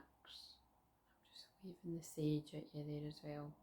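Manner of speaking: slow unvoiced whisper; right in your ear.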